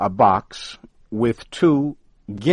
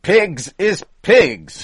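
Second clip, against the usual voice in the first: loud, much higher, slow and final; firmly; 12 semitones higher, 10 dB louder, slower than usual. Acted. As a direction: plant each word like a verdict. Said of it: "Flannery said firmly".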